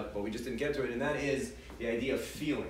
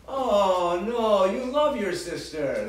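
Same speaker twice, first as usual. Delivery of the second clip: higher, louder, slower, sing-song parent; correcting parent; 6 semitones higher, 7 dB louder, slower than usual. Retold or imitated. Parent to child rejecting her feeling, mock-sweet correction. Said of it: "we'll say something like".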